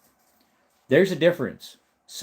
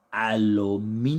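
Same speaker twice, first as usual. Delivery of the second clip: British pronunciation. Retold or imitated. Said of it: "So you gotta do it".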